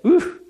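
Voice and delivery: sharp high oof grunt; a pained little oof. Real, not acted. Preacher reacting to Martha's doubt with oof.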